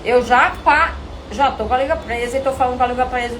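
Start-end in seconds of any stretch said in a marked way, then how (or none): none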